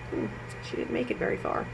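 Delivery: soft whispered aside. Shown as a demonstration. Teacher whispering to children about Cindy's fall. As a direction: lean in and whisper.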